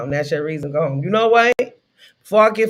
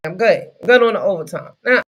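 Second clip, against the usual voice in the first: ordinary delivery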